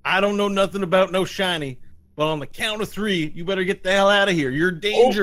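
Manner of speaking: high, loud sheriff's ultimatum; shouted warning. Acted. Sheriff warning a monster off, shouting. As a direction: lay down the law at full voice.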